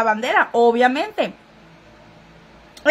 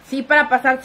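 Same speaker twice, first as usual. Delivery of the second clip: ordinary talk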